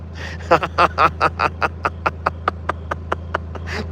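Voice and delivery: long breathy, wordless laugh. Real, not acted. Vlogger cracks up at a creepy sight, real laughter. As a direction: laugh helplessly in a long string. Amused by creepy-looking cow.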